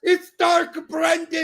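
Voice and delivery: high slow roaring hype; excited shout. Real, not acted. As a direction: announce the hero with a roar. Celebrating goal.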